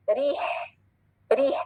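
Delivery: रीह with a light R and long ee; thin Arabic R. Shown as a demonstration. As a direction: let रीह end in a breathy h. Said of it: "बारीक रॉ की sound कैसी होती है".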